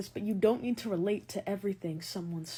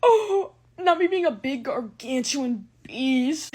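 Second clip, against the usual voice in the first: mock outcry; 4 semitones higher, 9 dB louder, much slower than usual. Self-mocking exclamation.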